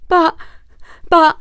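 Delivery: high, loud stammering plea; pleading stammer. Acted. Wolf stammering up at the fox, pleading. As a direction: stumble over the words, begging. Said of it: "pleaded the wolf".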